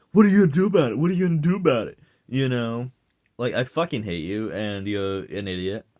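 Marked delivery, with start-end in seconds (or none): 0.1-2.0 shouted, higher, chest-puffed challenge; hammed-up tough guy getting in your face; roid rage guy. Imitating a roid-raging guy squaring up, with a hammed-up shouted challenge. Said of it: "get in my face and be like".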